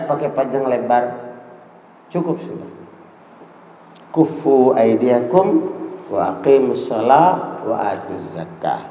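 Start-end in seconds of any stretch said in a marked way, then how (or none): 4.1-8.8 low, measured Arabic recitation; solemn Quranic quoting; scripture recitation. Real, not acted. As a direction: recite the verse in a lowered, deliberate voice.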